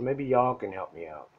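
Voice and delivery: normal talking voice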